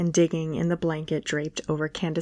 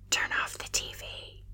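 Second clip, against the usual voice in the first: hushed, barely voiced whisper; 12 dB quieter than usual. A narrator's reading. Kyle whispers to the speaker so Candace sleeps on. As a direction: whisper so she stays asleep. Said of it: "Kyle whispered".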